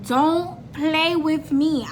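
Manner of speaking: slow, weighted "Don't play with me"; emphatic warning. Retold or imitated. Woman warning her man, slow and emphatic "Don't play with me". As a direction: draw out "Don't" and "play", spacing the words.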